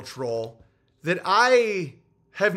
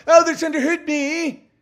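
much higher, louder whine of fake victimhood; mocking a whiny self-pitying victim; 9 semitones higher, 6 dB louder than usual. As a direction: wail it like a sarcastic crybaby. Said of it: "and then they go".

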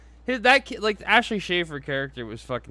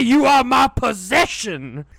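high, slow, loud claim of ownership; hammed-up domineering yell; shouted quote; 9 semitones higher, 7 dB louder, slower than usual. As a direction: roar it like a master. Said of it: "he's like".

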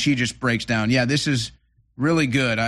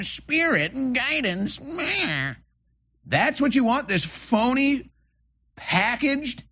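scornful, raised voice pitched higher; mocking high voice; 11 semitones higher than usual. Mocking the voters he talks to, scornful and high. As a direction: sneer at them in a high, derisive voice.

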